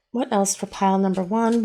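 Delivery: ordinary talk, nothing marked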